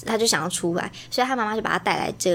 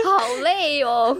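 worn out; 4 semitones higher, much slower than usual. Real, not acted.

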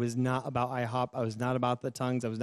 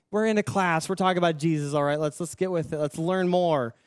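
louder, impatient retold protest; mad; 4 semitones higher, 4 dB louder than usual. Student wanting the class to carry on, mad. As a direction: push through it, mock-annoyed and insistent. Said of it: "I was just so mad".

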